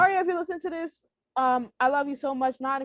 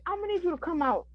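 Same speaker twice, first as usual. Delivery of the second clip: normal talking voice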